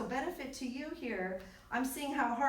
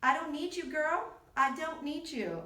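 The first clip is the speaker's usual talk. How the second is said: raised voice, firmly dismissing; firm telling-off; 5 semitones higher, 5 dB louder than usual. Real, not acted. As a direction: tell the phone off, loud. Telling off the phone assistant.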